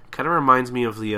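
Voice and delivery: usual speaking voice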